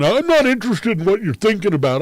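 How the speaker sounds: high, loud, talking down; condescending cutoff. Retold or imitated. The bully friend cutting off his answer, condescending and high. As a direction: cut him off like a belittling bully. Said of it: "condescending, belittling tone of voice".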